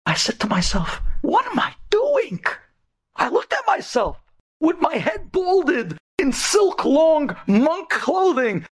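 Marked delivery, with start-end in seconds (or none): 1.2-2.4 high-pitched impassioned self-questioning; retold cry of sudden realization; anguished outcry. Retold moment of anguished awakening. 3.2-8.7 high, slowing, emphatic cry; dramatic storyteller at the climax; impassioned retelling. Retold or imitated. Impassioned climax of retold awakening.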